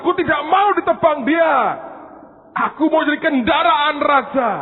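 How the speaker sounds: high, loud protesting shout; tree screaming no. Acted. Second tree refusing the woodcutters, shouting. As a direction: scream your refusal at them. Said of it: "Pohon itu juga teriak berkata".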